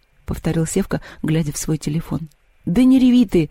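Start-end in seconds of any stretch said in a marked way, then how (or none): 2.7-3.5 loud, high snap at a crier; sharp shout. A narrator's reading. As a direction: cut her off hard. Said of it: "прикрикнул Антон на Машу". (Антон прикрикивает на плачущую Машу)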